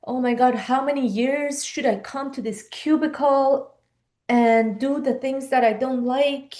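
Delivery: ordinary talk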